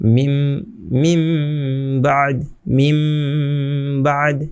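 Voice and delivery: মিম held in a long nasal hum, then বাদ; changed with ghunnah. Shown as a demonstration. Teacher demonstrates iqlab, মিম hummed long into বাদ. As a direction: stretch the final m of মিম through the nose before বাদ. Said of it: "গুণা করে এবং এটাকে পরিবর্তন করে".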